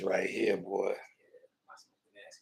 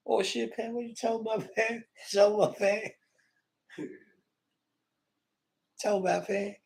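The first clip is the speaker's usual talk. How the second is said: mock high voice; 13 semitones higher, 4 dB quieter, faster than usual.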